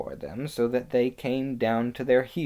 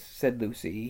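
usual speaking voice

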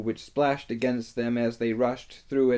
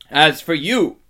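loud, raised, threatening; menacing rebuke; 5 semitones higher, 10 dB louder than usual. A narrator's reading. Witch turns on Edmund to punish him, menacing rebuke. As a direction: turn on the culprit with scorn.

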